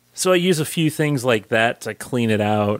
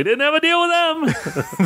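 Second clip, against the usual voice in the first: mock George voice. Seinfeld George impression, laughing.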